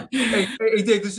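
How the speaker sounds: loud, laughing, high; laughing call-out. Real, not acted. Host interrupting Dave while laughing, loud call-out. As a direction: cut in while still laughing.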